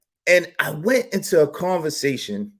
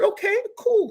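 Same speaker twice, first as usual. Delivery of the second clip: mock polite; 4 dB quieter than usual. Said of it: "I said".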